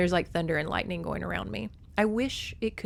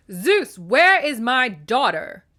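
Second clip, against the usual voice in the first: slow, loud, raised-pitch demand; shrieking; 6 semitones higher, 9 dB louder, slower than usual. A narrator's reading. Goddess confronts Zeus about her missing daughter, shrieking. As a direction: shriek it as an outraged mother. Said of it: "Demeter shrieked".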